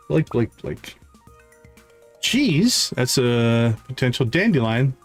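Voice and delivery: ordinary talk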